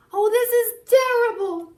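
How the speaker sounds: high, loud despairing mayor wail; panicked mayor crying out in dismay; mayor's cry. Acted. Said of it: "the mayor cried".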